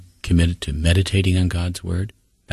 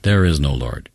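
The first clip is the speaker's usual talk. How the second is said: ordinary delivery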